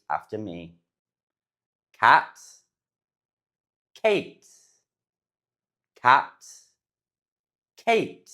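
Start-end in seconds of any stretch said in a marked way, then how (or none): 2.0-2.4 Cat with short flat A. Shown as a demonstration. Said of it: "you say them differently". 4.0-4.5 Kate with long A glide. Shown as a demonstration. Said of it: "you say them differently". 6.0-6.5 Cat with short flat A. Shown as a demonstration. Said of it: "you say them differently". 7.8-8.3 Kate with long A glide. Shown as a demonstration. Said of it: "you say them differently".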